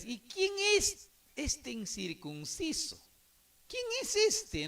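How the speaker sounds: pregunta desafiante actuada, muy aguda; desafío indignado. Acted. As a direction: imitando a David, tono alto que se dispara. David desafía al gigante, actuado. (incredulidad desafiante, fingida)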